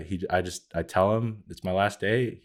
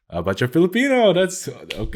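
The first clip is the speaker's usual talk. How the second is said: higher, louder imitation of the boss, a bit clueless; mock boss voice; 12 semitones higher, 7 dB louder than usual. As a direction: play the well-meaning, awkward boss. Boss reassures about being Filipino.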